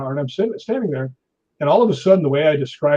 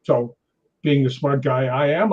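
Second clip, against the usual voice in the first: ordinary talk, nothing marked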